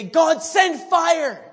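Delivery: high, drawn-out shout to heaven; screamed plea. Retold or imitated. Preacher showing how Elijah didn't pray, loud high shout. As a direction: cry out loud and slow for fire. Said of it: "scream and cry out for fire".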